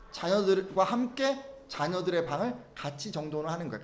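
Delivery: normal talking voice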